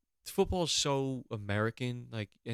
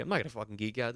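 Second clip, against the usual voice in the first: usual speaking voice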